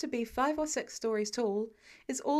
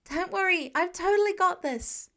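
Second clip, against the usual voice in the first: higher, loud cocky shout; 6 semitones higher, 5 dB louder than usual. A narrator's reading. Peter shouting down to the firefighters, confident and loud. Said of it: "Peter shouts down to them".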